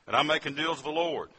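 ordinary talk, nothing marked

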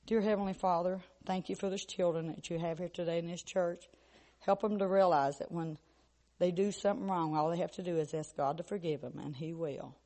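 put-on soft, earnest children's prayer voice. Pastor praying for the church children, softly. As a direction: pray gently over the children.

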